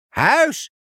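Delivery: high squawking parrot cry. Acted. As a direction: crow it out like an excited parrot. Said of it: "kraaide Benny". (hoge krassende papegaaienkreet)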